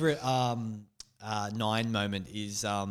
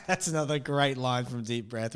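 usual speaking voice

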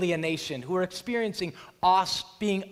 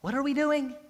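alarmed disciples; 8 semitones higher than usual. Retold or imitated. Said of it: "And they're going".

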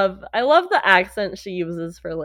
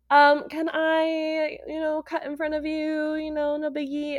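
sweet entitled ask; 6 semitones higher than usual. Retold or imitated. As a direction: ask sweetly like it's nothing. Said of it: "she's like".

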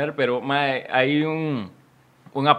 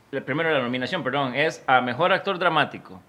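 usual speaking voice

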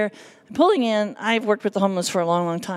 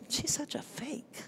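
a slow, sneering hushed aside; scornful whisper; 5 semitones higher, 14 dB quieter than usual. Pretend. Churchgoer whispering about her on the floor, sneering whisper. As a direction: whisper it slowly, like catty gossip behind her back. Imagined critic judging her.

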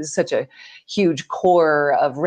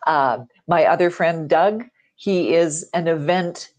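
ordinary delivery

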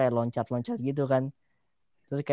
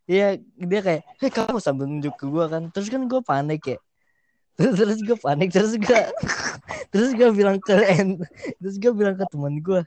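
excited retelling; 6 semitones higher, 6 dB louder than usual. Real, not acted. Excited laughing retelling of panic.

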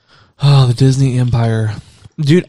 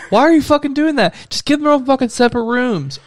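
exasperated; 11 semitones higher than usual. Real, not acted. Annoyed rant at the hosts.

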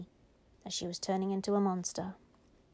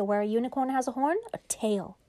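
ordinary delivery, nothing marked

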